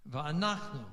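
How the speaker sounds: "Va'anachnu" with NACH stressed and a falling NU. Shown as a demonstration. Teacher correcting last-syllable stress, "Va'anachnu" stressed on NACH. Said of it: "It's on the nach".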